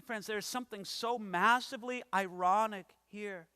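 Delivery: ordinary delivery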